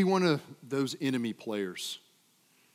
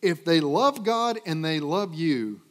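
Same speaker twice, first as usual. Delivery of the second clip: ordinary talk, nothing marked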